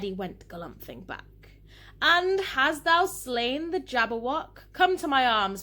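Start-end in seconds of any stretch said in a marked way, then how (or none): 2.0-5.6 loud, high, stately joy; triumphant greeting. A narrator's reading. Father hails victorious son in poem.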